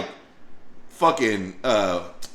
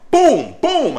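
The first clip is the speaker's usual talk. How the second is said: loud high boom boom; punch sound effects; 12 semitones higher, 7 dB louder than usual. Retold or imitated. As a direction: smack each word like a hit. Acting out punches again.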